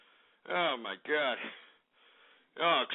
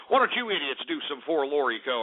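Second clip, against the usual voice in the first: ordinary talk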